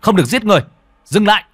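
high, loud shout to stop. Acted. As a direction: yell to halt the killing. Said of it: "quát lên".

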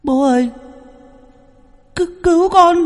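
put-on a ghost child crying out in pain; child's crying voice. Child's voice through the medium begs father for help.

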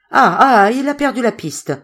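high exclamation after a loud "ah, ah"; excited reaction. A narrator's reading. Hearer repeats Zoé's news of the lost trail, excitedly. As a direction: seize on the news with a burst of excitement.

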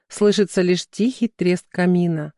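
ordinary delivery, nothing marked

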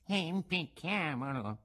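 "Impicchiamolo" slow, soft and sinister; second assassin's slow echo. A narrator's reading. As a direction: drawl "Impicchiamolo" as a cruel echo. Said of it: "ripeté l'altro". ("Impicchiamolo" ripetuto lento e sinistro)

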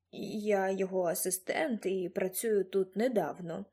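put-on slow, halting young assistant; faltering. A narrator's reading. Young assistant explains himself to visitor, faltering. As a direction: falter slowly through a shy explanation. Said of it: "затинаючись, зауважив містер Джордж".

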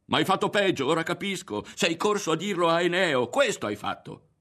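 high, loud angry charge; yelled accusation. A narrator's reading. Berto accuses Ario of running to Eneo, yelling. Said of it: "urlò".

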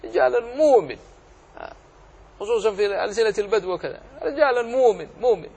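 hammed-up Bedouin dialect with mumin; Bedouin accent. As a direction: imitate a Bedouin saying مؤمن without the hamza. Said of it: "إنما يقول".

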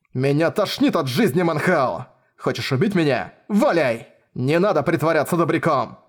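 hysterical rant. Acted. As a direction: rage at him, losing control. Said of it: "истеричной тираде".

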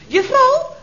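a hint of a loud, high market seller calling out; market vendor. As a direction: call her like a hawker at the market. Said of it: "Hij zegt". (lichtjes nagedaan marktkoopman)

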